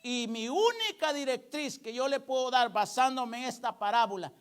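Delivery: usual speaking voice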